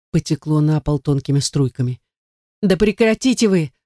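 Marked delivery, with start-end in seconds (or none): 2.6-3.7 high-pitched, exasperated cry; a contained shout, pitched up high. A narrator's reading. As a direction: plead urgently for them to stop. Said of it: "закричал я громче".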